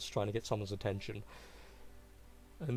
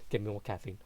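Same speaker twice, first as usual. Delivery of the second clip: usual speaking voice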